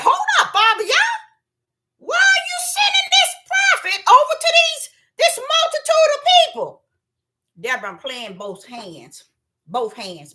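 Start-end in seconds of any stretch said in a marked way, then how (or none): 0.0-6.8 very high, loud incredulous questioning; acted protest. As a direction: cry out to God in mock disbelief. Acts out protesting to God.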